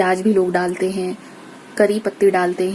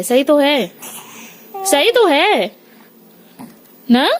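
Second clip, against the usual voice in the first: put-on baby talk; 9 semitones higher, 3 dB louder than usual. Mother soothing baby.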